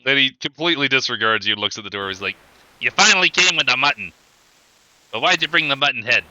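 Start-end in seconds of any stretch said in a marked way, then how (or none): 2.8-6.2 goblin voice. Acted. GM voicing goblin barkeep.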